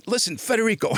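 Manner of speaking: high-pitched mock exasperation; retold irritation. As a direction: imitate an agent losing patience. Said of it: "he's getting really pissed off with me now".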